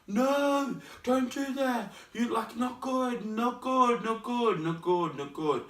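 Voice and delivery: high mock teacher scolding, fading down to normal. Teachers warning a would-be dropout, high mock scolding. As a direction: start high and urgent, let the repeats sink. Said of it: "Then we had my teachers saying".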